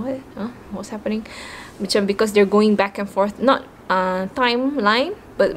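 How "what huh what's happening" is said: soft, puzzled mock reaction; confused muttering. Reader lost in the story, softly confused. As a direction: mutter it as if losing the thread. Imitates herself lost while reading.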